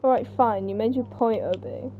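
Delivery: ordinary talk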